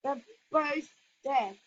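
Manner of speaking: ordinary talk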